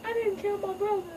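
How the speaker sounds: high, hammed-up sobbing protest; teary young lion pleading innocence; mock crying. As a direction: whine it through fake tears. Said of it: "Kobu's like".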